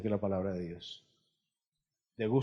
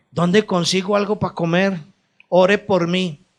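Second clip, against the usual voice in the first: higher, louder pleading bird voice; a hint of a whiny bird; mock begging bird; 6 semitones higher, 10 dB louder than usual. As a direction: beg like a hungry pelican. Imagined pelican begging for food.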